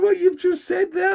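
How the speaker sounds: high, slow, incredulous mock voice; Lisa's indignant question. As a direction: imitate Lisa demanding an answer, pitched high. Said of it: "She's going".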